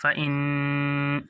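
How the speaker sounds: very slow, drawn-out ghunna; فا ان with the ان held long and slow; held nasal count. Shown as a demonstration. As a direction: stretch the nasal sound long. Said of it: "یہاں گننا ہوگا۔".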